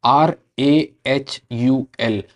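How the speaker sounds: आर ए एच यू एल spelt slowly letter by letter; spelling in English. Shown as a demonstration. Teacher to students, slow English spelling. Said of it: "English में बोलेगा".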